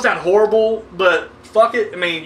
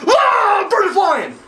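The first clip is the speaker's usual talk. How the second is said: mock jump scare; 10 semitones higher, much slower than usual. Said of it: "it's a loud cut".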